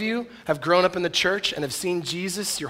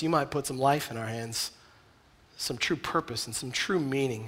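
prayer. Acted. Closing prayer.

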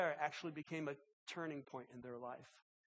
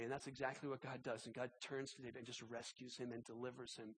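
ordinary talk

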